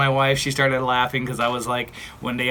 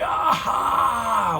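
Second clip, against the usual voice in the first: pained cry; 9 semitones higher than usual. Retold or imitated. Sudden pain on plane.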